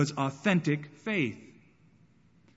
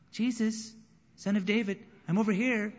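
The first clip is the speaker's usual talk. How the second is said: politely raised, half-hearted call; a beggar calling to Jesus, meek and unsure; timid call; 6 semitones higher than usual. Retold or imitated. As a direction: ask meekly to be noticed. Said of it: "It wasn't".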